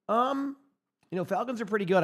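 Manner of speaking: bright high burst; excited. Real, not acted. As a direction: lift the voice up eager. Sudden lift.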